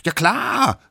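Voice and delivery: high, loud, confident reply; calling down. A narrator's reading. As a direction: call down from the lookout. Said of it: "rief der Allerkleinste nach unten". (hoch und laut heruntergerufen)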